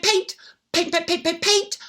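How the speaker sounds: usual speaking voice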